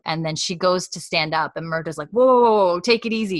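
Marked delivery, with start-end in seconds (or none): 2.1-3.4 higher, loud retold "whoa"; mock alarmed whoa. Acted startled man calming fainted woman.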